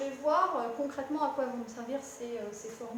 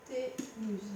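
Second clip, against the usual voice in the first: syllable by syllable; 4 semitones lower, 4 dB quieter than usual. Shown as a demonstration. Teacher repeating the term for the student, syllable by syllable. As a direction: say "Hypoténuse" in pieces, as if dictating. Spells out term while writing.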